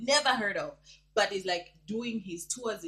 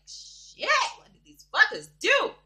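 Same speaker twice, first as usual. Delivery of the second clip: higher, louder startled outburst; shocked exclaim; 14 semitones higher, 10 dB louder, slower than usual. Retold or imitated. Vlogger recalling her reaction to the threat, shocked. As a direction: react as if caught off guard. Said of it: "I was like".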